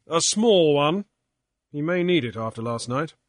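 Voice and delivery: loud, brisk captain's voice; Grief, hard captain. Acted. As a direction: lay down the law. Grief lectures and orders crew.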